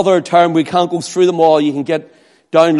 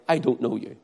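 hushed rejection; 6 semitones lower, 11 dB quieter, faster than usual. Acted. Christ rejecting on judgment day.

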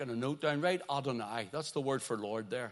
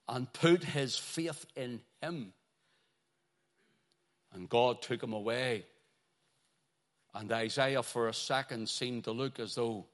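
normal talking voice

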